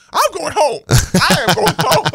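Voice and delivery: high, loud falsetto of the frazzled mom; the mom near tears, insisting. Retold or imitated. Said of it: "And she's like".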